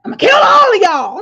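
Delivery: hurled at everyone, near a scream; a husband's furious yell, pitched high and very loud; furious threat. Retold or imitated. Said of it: "My husband was like".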